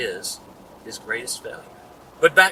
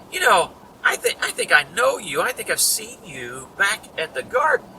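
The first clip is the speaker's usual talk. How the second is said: polite accuser; 7 semitones higher, 4 dB louder than usual. Acted. Malchus's relative speaks up.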